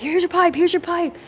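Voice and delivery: high-pitched crazed mock outburst; totally losing it. Apprentice hurling pipe at coworker, high crazed outburst. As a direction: replay a meltdown, voice shooting up. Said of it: "I just totally lost it".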